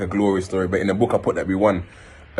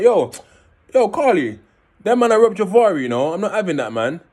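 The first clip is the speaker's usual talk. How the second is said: angry call; 8 semitones higher than usual. Retold or imitated. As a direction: demand backup, heated. Angrily calls brother about robbery.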